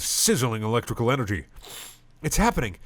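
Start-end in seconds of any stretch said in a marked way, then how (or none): 2.2-2.8 high, eager cry; excited shout. Acted. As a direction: blurt it out thrilled. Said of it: "Purpur shouts excitedly".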